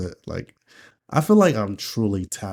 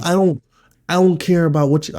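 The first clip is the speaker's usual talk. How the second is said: attempted yell; 8 semitones higher, 7 dB louder than usual. Shown as a demonstration. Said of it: "I forgot how I did it".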